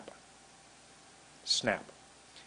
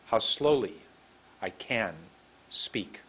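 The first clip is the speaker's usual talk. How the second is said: slowly; 3 semitones lower, slower than usual. Shown as a demonstration. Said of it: "but how slowly I can speak".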